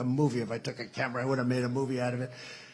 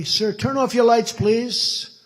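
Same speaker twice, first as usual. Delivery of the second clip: higher and louder request; loud polite order; 7 semitones higher, 7 dB louder, much slower than usual. Retold or imitated. Pilots telling the president before landing, loud polite request. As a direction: urge it firmly but politely.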